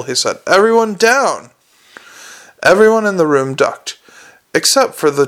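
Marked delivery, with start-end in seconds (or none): 0.5-1.5 called warning. A narrator's reading. Alchemist calls out a warning.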